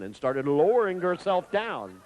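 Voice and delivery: ordinary delivery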